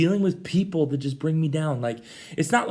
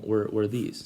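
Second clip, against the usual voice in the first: ordinary talk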